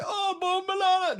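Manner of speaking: very high, loud mock Jamaican outcry; slow, stretched caricature of Jamaican rage; Jamaicans freaking out. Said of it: "Dude, they started freaking out".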